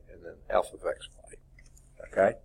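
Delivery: hoarse whisper as the voice gives out; raspy whisper. Real, not acted. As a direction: voice fading to a hoarse rasp. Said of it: "voice is going again".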